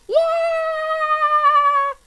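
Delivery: very high, loud goblin shriek; goblin scream. A narrator's reading. Goblins cheering their boss, high shriek. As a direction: shriek like a gleeful goblin mob. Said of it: "screamed the goblins".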